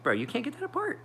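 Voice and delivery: high mock voice for his friend; a squeaky mock friend voice; imitating Elliot. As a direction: pitch way up to quote Elliot teasingly. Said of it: "he's like".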